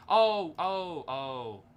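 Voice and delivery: real shock at a missed threat; startled oh. Real, not acted. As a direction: caught off guard by the move. Blunder spotted mid-game.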